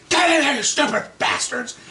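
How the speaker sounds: high, loud angry yell; imitated scream at dogs. Owner yelling his dogs back inside, high angry shout. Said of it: "he starts screaming at this dog".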